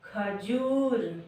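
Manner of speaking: teacher modelling the answer; slow drawn-out answer. Shown as a demonstration. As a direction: stretch खजूर out slowly. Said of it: "क्या हुआ?".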